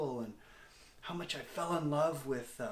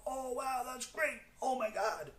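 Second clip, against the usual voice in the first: much higher, gushing fake enthusiasm; mock excited gush; 12 semitones higher than usual. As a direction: imitate people squealing with excitement. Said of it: "people, like, really excited".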